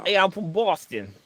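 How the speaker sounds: mock Boston accent, drawn out. Friend clowning as someone from South Side Boston, mock Boston accent. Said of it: "how you like them apples kinda accent".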